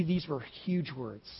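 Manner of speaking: ordinary talk, nothing marked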